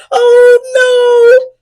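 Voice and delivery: high, loud dismayed shout. A narrator's reading. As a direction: cry out in shock. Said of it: "shout the firefighters".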